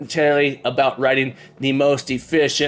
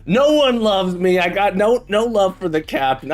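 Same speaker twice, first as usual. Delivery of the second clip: mock lament; 4 semitones higher than usual. Captain jokes to chat about getting no love, mock lament. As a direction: ham up feeling unloved. Pretend lament, then just kidding.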